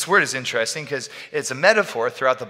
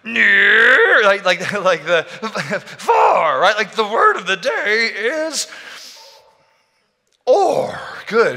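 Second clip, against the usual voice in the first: Grover voice; 8 semitones higher, 7 dB louder, much slower than usual. Retold or imitated. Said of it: "you know, Grover".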